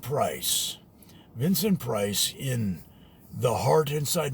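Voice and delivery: low, slow horror host; spooky TV host imitation, low and slow; low scary host voice. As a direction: intone it like a late-night creature feature host. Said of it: "he'd talk in this low voice".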